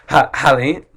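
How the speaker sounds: ordinary talk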